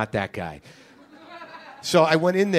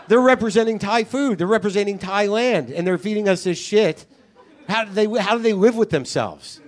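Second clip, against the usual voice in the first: loud, higher-pitched outraged tirade; indignant rant; 7 semitones higher, 4 dB louder than usual. Retold or imitated. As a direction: rail in mock outrage at their lost integrity. Acting out his indignant inner rant.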